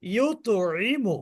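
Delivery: ইউতইমু drawn out slowly, the ত bounced clearly; slow qalqala recitation. Shown as a demonstration. Teacher models ইউতইমু for students, slow and echoing. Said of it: "ভালো করে উচ্চারণ করতে হবে।".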